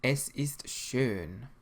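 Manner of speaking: "Es ist schön" slow and clear, ö like burn; careful teacher's pronunciation; slow model answer. Shown as a demonstration. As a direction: say it slowly for learners to copy. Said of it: "how would you say in German".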